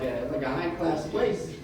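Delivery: ordinary delivery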